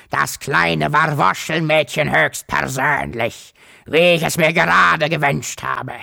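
fremde Monsterstimme, ärgerlich und gierig; krächzendes Monster. A narrator's reading. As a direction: ein zotteliges Ungeheuer, das vor sich hin schimpft. Mamoffel freut sich über Fang. (krächzende Monsterstimme, zornig)

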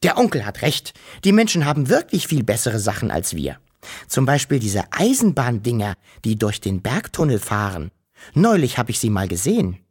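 usual speaking voice

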